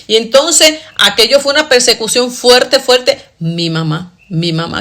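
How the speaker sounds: ordinary delivery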